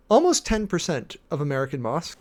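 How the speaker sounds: usual speaking voice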